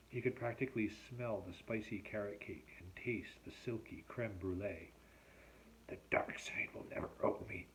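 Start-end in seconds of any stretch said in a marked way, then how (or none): 5.9-7.7 husky, unvoiced vow to oneself; vowing to himself. A narrator's reading. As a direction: make a private, solemn vow under the breath. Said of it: "Derek vowed to himself".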